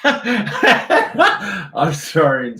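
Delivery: high, slow words squeezed through real laughter; can barely talk for laughing; laughing hard. Real, not acted. Cracks up at own silly song.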